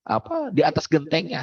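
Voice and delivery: usual speaking voice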